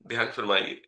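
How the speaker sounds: ordinary talk, nothing marked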